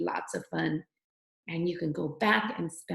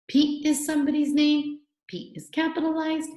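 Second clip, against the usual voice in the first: usual speaking voice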